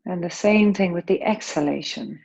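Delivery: calm, unhurried breath-awareness guidance; yoga teacher easing the class into breathing; guided meditation. Acted. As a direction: gently guide their attention through each breath. Leads a breath awareness practice.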